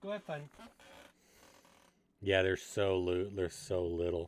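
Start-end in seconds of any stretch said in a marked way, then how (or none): 0.0-0.4 pet talk. Real, not acted. Owner softly cooing as she lets the rats go play. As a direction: send them off sweetly. Sending pets off.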